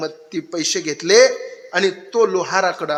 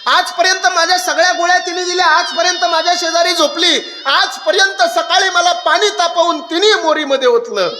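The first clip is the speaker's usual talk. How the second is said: high, aching lament; a father mourning his little girl's departure; grieving father; 9 semitones higher, 3 dB louder than usual. Acted. Father laments daughter leaving. (लेक सासरी जाताना रडणारा बाप, उंच आवाजात)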